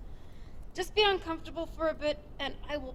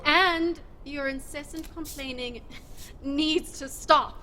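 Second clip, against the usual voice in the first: slow, deliberate, louder at the end; stern warning; 3 dB louder, slower than usual. Acted. Leader laying down rules to Udo, slow stern warning. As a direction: drag it out to make the point. Scolding slowly.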